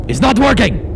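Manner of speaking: high, loud frustrated outburst; exasperated snap. Acted. Captain snapping at Skip's reassurances, exasperated outburst.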